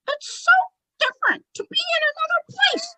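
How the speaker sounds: very high, whispery ventriloquist voice; childlike spirit in breathy falsetto; Tommy. Acted. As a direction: marvel in a squeaky, half-whispered puppet voice. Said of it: "And then Tommy goes".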